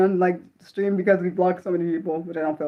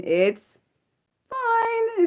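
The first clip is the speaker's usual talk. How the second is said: strained fine; 13 semitones higher, 3 dB quieter than usual. Real, not acted. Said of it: "Breathe, [name]".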